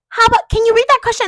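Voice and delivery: high, bright teacher request; mock teacher. Student mimics the teacher picking a reader, high. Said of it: "she'd be like".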